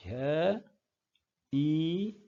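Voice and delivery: usual speaking voice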